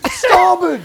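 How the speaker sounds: high, very loud holler; mock ship's warning. Friend joins the sinking-ship gag, mock warning shout. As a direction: bellow it across the deck.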